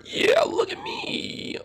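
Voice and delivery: whispery little character. Pretend. Gil shows off his little cubby, high whispery voice. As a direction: stretch it out in a high, airy whisper.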